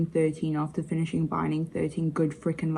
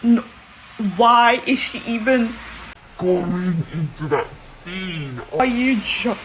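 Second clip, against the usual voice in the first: alarmed outburst; 7 dB louder than usual. Real, not acted. Reader protesting loudly at the tense scene. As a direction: cry out in alarm.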